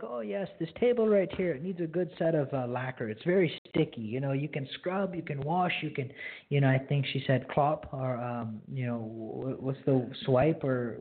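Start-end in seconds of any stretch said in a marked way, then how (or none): 0.0-6.1 a lightly imitated Mennonite accent, higher. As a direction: quote the lady with a mild Mennonite lilt, pitched up. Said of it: "I make a really bad, um, Mennonite accent".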